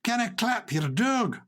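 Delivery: amateur Scottish accent; attempted Scottish accent. Shown as a demonstration. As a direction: try a Scottish accent, a little slowly. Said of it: "I'm getting the accent right".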